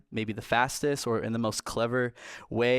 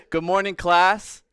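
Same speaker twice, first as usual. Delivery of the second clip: loud, sharp classroom greeting; teacher voice; 6 semitones higher, 6 dB louder, slower than usual. Shown as a demonstration. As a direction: call the class to order like a teacher. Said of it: "That is my teacher voice".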